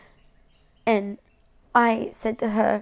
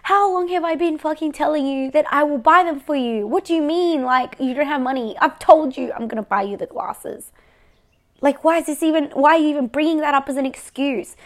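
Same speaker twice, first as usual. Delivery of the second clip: louder, higher, exasperated tirade at her mother; retold angry rant; 4 semitones higher, 5 dB louder than usual. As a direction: vent the frustration, rising and loud.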